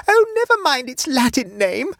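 very high, loud alarmed cry; screamed. A narrator's reading. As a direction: cut in with a panicked scream. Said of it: "screamed Mavis".

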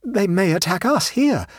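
high, nervous traveller; a touch of trembling alarm; tremulous. A narrator's reading. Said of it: "said Abbleway tremulously".

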